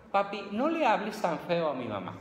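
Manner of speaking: put-on high child's voice pleading. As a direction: beg dad like a little boy. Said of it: "le dice a su papá". (niño pidiendo a papá, voz aguda)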